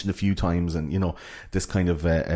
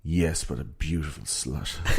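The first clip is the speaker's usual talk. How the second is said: lower, softer, slow smug reply; mock debonair Nick, quieter and slower; low suave aside; 4 semitones lower, 5 dB quieter, slower than usual. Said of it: "he's like".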